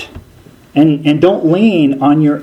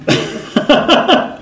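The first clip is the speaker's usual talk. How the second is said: breathy laugh; 12 semitones higher than usual. Real, not acted. Laugh after imitation.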